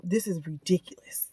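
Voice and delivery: under-the-breath whispered disbelief; hushed angry aside. Retold or imitated. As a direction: mutter it as a furious hush. Angry mom to school staff.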